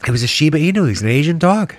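movie star voice. Pretend. Mock actor in dog movie.

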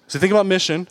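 ordinary talk

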